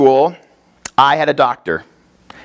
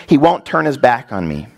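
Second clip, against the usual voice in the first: ordinary talk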